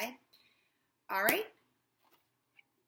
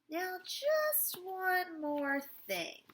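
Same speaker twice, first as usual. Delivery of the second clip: high, very slow scheming doctor; drawn-out sly hypnotist line; 10 semitones higher, 4 dB louder, much slower than usual. Acted. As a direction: savor every word slyly.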